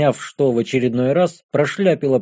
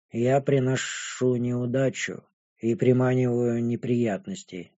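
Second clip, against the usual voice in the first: put-on impassive, resigned dragon girl; 4 semitones lower, 7 dB quieter than usual. A narrator's reading. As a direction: warn them softly, resigned to being bad luck.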